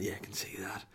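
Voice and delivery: put-on soft whispered reply to a child; hushed parent's whisper. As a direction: whisper back patiently. Dad answers toddler at night.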